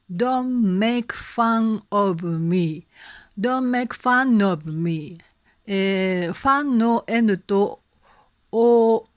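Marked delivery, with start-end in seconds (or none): 0.1-5.2 very slow, each word spelled out for the class; slow model phrase. Shown as a demonstration. Teacher to students learning English, slow model phrase. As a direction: lay it out word by word like a patient teacher. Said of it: "Please repeat after me".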